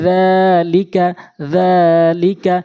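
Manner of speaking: যালিকা with long held যা, quick লিকা; slow recited যালিকা, opening vowel drawn out; one-alif elongation on যা. Shown as a demonstration. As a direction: stretch the first syllable of যালিকা, then clip লিকা short. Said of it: "জালটি এক আলিফ টেনে পড়তে হবে".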